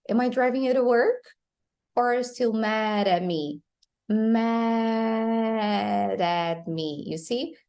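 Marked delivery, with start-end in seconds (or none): 0.1-3.6 quick run-on line, "to" and "mad at" tapped; tap sounds. Shown as a demonstration. Teacher models the whole question for learners, tapped T and D. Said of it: "like a tap sound". 4.1-7.0 "Mad at" very slow, long vowel into a tapped D; drawn-out tapped D. Shown as a demonstration. Teacher shows D between vowels, slow tapped D. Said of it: "Because the D is between vowels".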